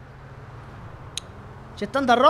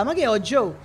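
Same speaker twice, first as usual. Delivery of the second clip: normal talking voice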